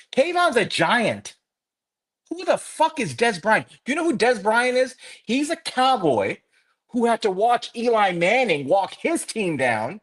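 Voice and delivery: high-pitched, emphatic angry rant; indignant outburst. Real, not acted. Fan defending Kayvon against Dez Bryant, indignant outburst.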